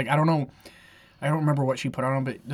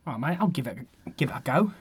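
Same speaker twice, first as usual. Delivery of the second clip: low-key, softer Cockney warm-up; Cockney accent; 3 semitones lower, 7 dB quieter, much faster than usual. Pretend. Accepting the listener's challenge in a Cockney accent. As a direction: try out a casual Cockney voice. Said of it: "your best Cockney accent".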